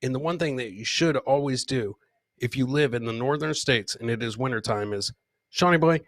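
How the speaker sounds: ordinary talk, nothing marked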